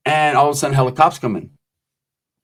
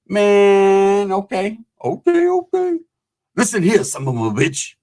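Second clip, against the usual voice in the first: Bernie Mac impression; 6 semitones higher, slower than usual. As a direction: do Bernie Mac revving up to scold someone. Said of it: "That's my Bernie Mac, uh, uh, impression".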